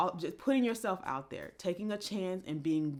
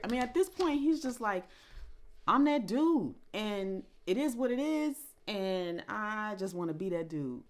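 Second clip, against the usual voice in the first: higher and louder, "I'm that dude" thrown out; excited imitation; 6 semitones higher, 5 dB louder than usual. Vlogger mimics the rapper's boastful confidence excitedly. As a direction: playfully voice the carefree rapper. Imitating J. Cole's carefree attitude.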